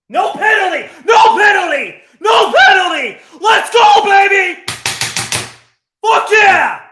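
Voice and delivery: high, loud celebration; screaming with joy at the decision; jubilant shouting. Real, not acted. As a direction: roar with relief as the call goes your way. Penalty overturned, celebrating live.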